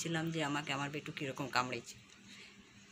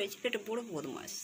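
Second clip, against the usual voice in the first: ordinary talk, nothing marked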